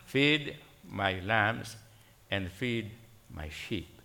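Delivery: quoting Christ slowly, gently lowering the voice; slow solemn quote. Retold or imitated. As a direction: let each phrase of the quoted command settle. Voicing Christ's charge to Peter.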